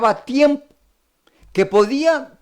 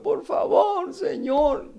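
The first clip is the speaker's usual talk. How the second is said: high pleading cry, voice pitched way up; imitating a tearful plea; 12 semitones higher, slower than usual. Indebted servant begging his master, high tearful plea. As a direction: beg like a man in tears, high and thin. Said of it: "estaba llorando".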